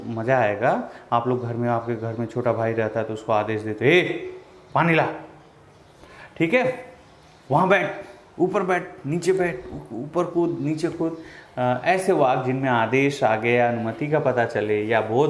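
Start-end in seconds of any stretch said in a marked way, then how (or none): 3.9-5.1 bossy order. Acted. Ordering little brother. 6.4-11.2 bossy order. Acted. Ordering little brother.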